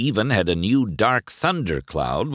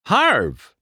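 high call, falling at the end; 10 semitones higher, 4 dB louder than usual. A narrator's reading. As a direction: call Harv firmly into the trailer. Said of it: "McQueen called into the trailer".

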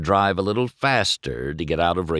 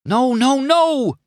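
loud, very high-pitched plea; desperate begging; 5 dB louder, slower than usual. A narrator's reading. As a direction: beg them not to leave. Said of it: "McQueen begged".

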